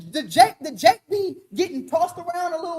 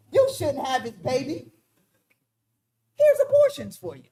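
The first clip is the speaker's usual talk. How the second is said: mock helpful government voice; 5 semitones higher than usual. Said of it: "He said".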